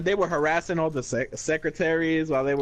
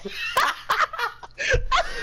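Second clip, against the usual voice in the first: high giggle; laughing. Real, not acted. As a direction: burst into giggles. Laughs at the quote.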